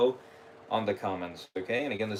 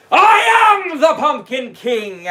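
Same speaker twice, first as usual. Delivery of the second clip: booming, slow, high shout; startling shout; 14 semitones higher, 12 dB louder, slower than usual. Acted. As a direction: roar it out to scare. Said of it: "Did I scare you?".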